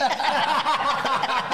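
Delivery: big laugh; cracking up; loud laughter. Real, not acted. Laughs at jacket joke.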